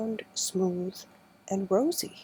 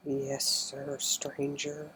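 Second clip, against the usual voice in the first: low, soft and solemn reply; low, musical voice; 4 semitones lower, 6 dB quieter, slower than usual. A narrator's reading. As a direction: drop low and soft, like a sad little man. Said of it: "he said in a low, musical voice".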